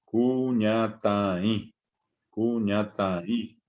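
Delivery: Cuñataí drawn out slowly with the nasal on the final í; teacher's slow demo of nasal í in Cuñataí. Shown as a demonstration. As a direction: stretch Cuñataí and nasalize the last í. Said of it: "La nasalidad está en la i".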